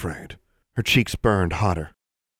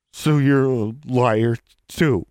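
higher, slow scornful jab; 5 semitones higher, 4 dB louder, slower than usual. Acted. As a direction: sneer the accusation. Lip curled, scornful.

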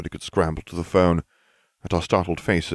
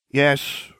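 a higher, slightly stiff answer; 8 semitones higher than usual. A narrator's reading. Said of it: "Ron said stiffly".

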